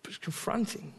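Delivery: unvoiced whispered remark. Real, not acted. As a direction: breathe it out as a whisper. Whispered aside.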